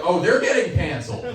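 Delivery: emphatic mock alarm; knowing alarm. Alarmed reaction, set against citizenship.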